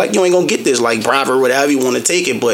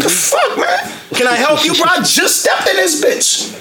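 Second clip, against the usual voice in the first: high-pitched irritated rant, laughing through; going off; 13 semitones higher than usual. Retold or imitated. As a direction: snap at the clerk, half laughing. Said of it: "I be going off on the Arabs".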